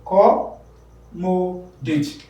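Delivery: Accommodate slowly split into syllables, CO standing out; ac-CO-mo-date, spelled out slowly; stress on second syllable. Shown as a demonstration. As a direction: pause between the four syllables of Accommodate, leaning on CO. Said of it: "It is the second one".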